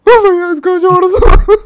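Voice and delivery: very high, loud, drawn-out sobbing plea; hammed-up bawling, stretched out slowly; mock wailing. Storyteller mimicking tearful boy begging him to back off, mock wailing. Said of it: "ऐसे आंसू पोंछता बोलता".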